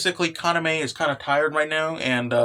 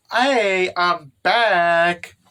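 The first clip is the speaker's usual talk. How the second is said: calling out; 2 semitones higher, 5 dB louder, much slower than usual. Acted. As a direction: announce your return to the room. Character calling out on return.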